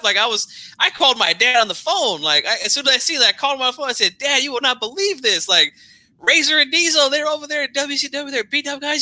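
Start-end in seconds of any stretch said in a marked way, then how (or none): 4.2-9.0 high, breathless excited news for dad; a boy phoning his dad with big news, excited; excited kid. Retold or imitated. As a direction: blurt it out like an amazed kid.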